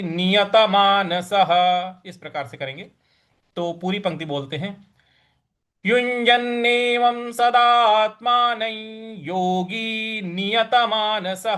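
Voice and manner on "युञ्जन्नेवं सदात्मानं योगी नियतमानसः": full Sanskrit line in a chanting voice; measured scripture chant; verse recitation. Acted.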